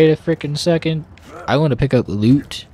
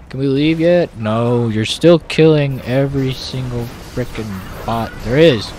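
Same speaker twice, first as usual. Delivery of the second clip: usual speaking voice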